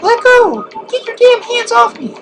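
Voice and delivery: put-on high squeaky falsetto for the girl; hammed-up high-pitched damsel protest. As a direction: shriek it way up in a girly falsetto. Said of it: "And we get Carrie".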